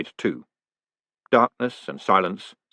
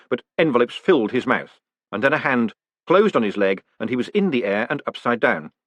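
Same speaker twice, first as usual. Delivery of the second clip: ordinary delivery, nothing marked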